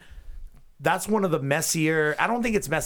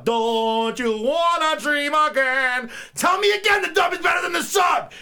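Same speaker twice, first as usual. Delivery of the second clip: much higher, louder furious yell; angry yell; 14 semitones higher, 5 dB louder than usual. Acted. Host yelling mock lyrics at the English dub, angry. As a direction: rage at the dub. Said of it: "that was the first angry yell".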